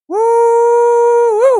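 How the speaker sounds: high, loud whooping cheer. Acted. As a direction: whoop it out like a hype man. Streamer cheering on wholesome stream.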